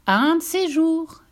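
high, slow farewell call; calling goodbye. Acted. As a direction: stretch it out as she dives. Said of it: "cria la goutte d'eau".

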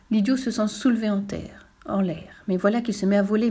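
ordinary delivery, nothing marked

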